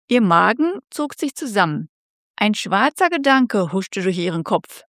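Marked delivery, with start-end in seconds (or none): none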